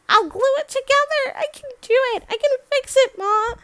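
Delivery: high, loud, desperate insistence; frantic pleading. Acted. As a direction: plead urgently to put it right. Daughter pleads to mend it.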